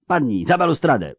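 high, pressing command; urgent warning. A narrator's reading. Witcher ordering friends behind balustrade, urgent warning. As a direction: call them down into cover.

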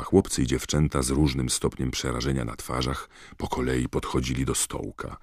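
plain reading voice

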